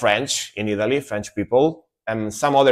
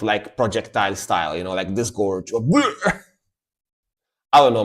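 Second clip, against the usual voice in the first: usual speaking voice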